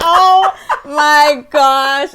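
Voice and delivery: loud, slow, stretched words breaking into laughs; cracking up while telling the story; helpless real laughter. Real, not acted. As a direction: gasp the words out through laughter. Helpless laughter retelling her story.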